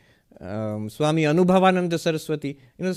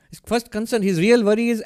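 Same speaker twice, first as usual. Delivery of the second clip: ordinary talk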